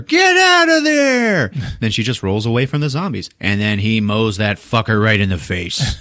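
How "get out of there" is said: loud, high, drawn-out warning shout; retold yell. Rusty with mower warning Cindy, imitated drawn-out yell. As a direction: holler it across the yard. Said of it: "he just yells to her".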